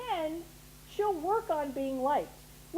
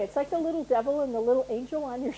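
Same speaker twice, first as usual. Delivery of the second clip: ordinary talk, nothing marked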